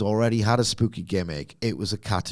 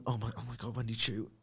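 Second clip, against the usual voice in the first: hushed scared gasp; 13 dB quieter, faster than usual. Retold or imitated.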